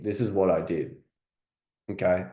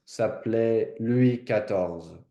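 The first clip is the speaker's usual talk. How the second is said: slow, careful French accent; French repeat-after-audio; 2 semitones higher, slower than usual. Shown as a demonstration. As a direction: echo the French recording, taking it slowly. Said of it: "Play the first part, pause".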